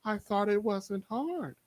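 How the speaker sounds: sly grandma retort. Retold or imitated. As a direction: deliver the dry comeback slowly and quietly. Grandma's sly comeback in story.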